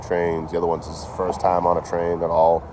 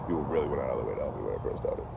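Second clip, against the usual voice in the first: quieter restatement; 5 semitones lower, 12 dB quieter, faster than usual. Real, not acted. Repeats the line more quietly.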